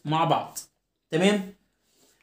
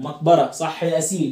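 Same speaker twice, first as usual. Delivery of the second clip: usual speaking voice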